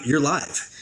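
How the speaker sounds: normal talking voice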